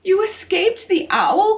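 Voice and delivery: high, loud, amazed cry; excited cry. A narrator's reading. Stellaluna amazed to find her mother alive, high and excited. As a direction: burst out in joyful disbelief. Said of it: "cried Stellaluna".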